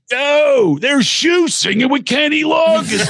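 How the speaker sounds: put-on slow, high drunken shout, laughing; drunk Philly friend. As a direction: holler in disbelief, slurred. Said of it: "he goes to his buddies".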